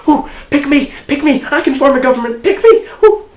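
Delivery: high, loud, eager pupil begging to be picked; hammed-up schoolkid excitement, very high and loud; overly excited pupil. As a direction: squeal it out like the class keener. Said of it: "like that overly excited class".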